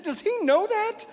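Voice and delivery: very high, drawn-out incredulous question; mock alarm. Husband asking if their son knows, alarmed. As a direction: climb into comic panic.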